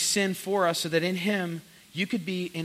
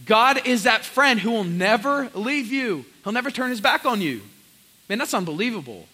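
normal talking voice

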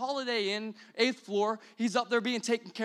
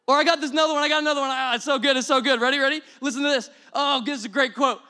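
mock eager; 5 semitones higher, 8 dB louder, faster than usual. Persona gushing over a quote.

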